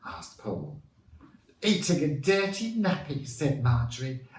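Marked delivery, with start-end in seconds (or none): 1.6-3.3 high-pitched middle-aged lady; worried witness. A narrator's reading. Marjorie tells the reporter what it ate, as a worried lady. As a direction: pitch it high as a scandalised neighbour.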